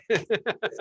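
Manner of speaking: quick bright burst of laughter; short high laugh. Real, not acted. Host laughs about the price of a front-row seat, short high laugh. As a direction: laugh it off lightly. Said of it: "Yeah, that's so cool".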